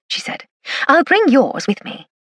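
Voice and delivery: plain reading voice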